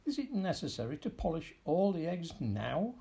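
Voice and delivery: a touch of irritation, rising at the end; impatient cat nagging mildly; irritable cat. A narrator's reading. Cat nags old man to hurry, mildly irritated. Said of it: "said the cat irritably".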